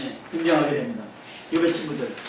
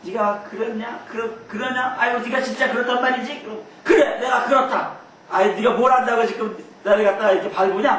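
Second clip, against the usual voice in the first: higher, taunting back and forth; mocking quarrel; 5 semitones higher than usual. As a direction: jeer at him like a sparring rival. Acted quarrel between Job, friends. (빈정대며 높은 목소리로 따지듯)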